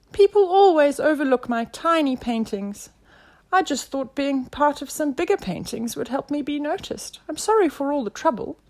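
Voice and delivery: high little creature voice; tiny artist pleading to be noticed; small, high, apologetic explanation; squeaky caterpillar. A narrator's reading.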